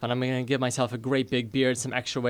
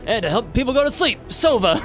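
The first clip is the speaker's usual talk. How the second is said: higher, louder incantation; dwarf casting sleep on the doctor; casting a spell; 10 semitones higher, 4 dB louder than usual. Acted. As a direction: cast the sleep spell with flourish. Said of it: "I say".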